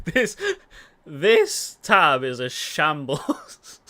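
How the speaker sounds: loud, slow, drawn out through laughter; laughing. Real, not acted. Player despairing at his messy tab, laughing. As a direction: crack up while saying it.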